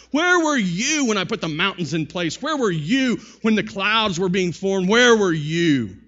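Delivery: loud, commanding challenge; thundering divine rebuke. Acted. God confronting Job over creation, thundering. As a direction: boom each question like an almighty interrogator.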